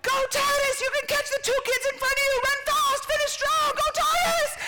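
high, loud shouted cheering; cheering parent. Retold or imitated. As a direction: yell him on from the stands. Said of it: "we're, like, going".

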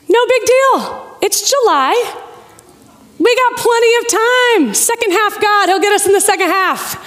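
high, singsong self-reassurance; breezy reassurance. Retold or imitated. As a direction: insist lightly that all is fine. Repeating the breezy optimism later on.

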